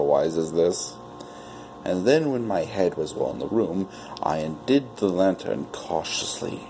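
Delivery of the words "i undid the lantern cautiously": ordinary delivery, nothing marked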